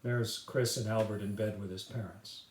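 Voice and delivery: plain reading voice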